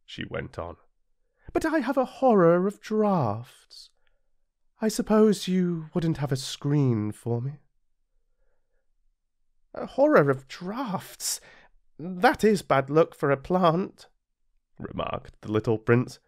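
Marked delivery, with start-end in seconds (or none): 1.5-7.6 high, dainty flower; sweetly polite, a touch vain; lightly voiced proud flower. A narrator's reading. Flower voice held. 9.7-14.1 put-on high, slow, wondering boy; a puzzled child repeating her words; lightly voiced little prince. A narrator's reading.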